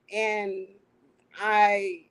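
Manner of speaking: ordinary delivery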